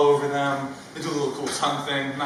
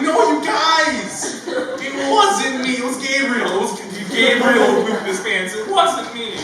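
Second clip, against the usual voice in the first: high loud whiny pleading, near tears; tearful denial; 9 semitones higher, 8 dB louder than usual. Retold or imitated. Boy near tears denying to classmates, high whiny plea. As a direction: beg them to believe you, voice cracking. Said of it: "starting to tear up".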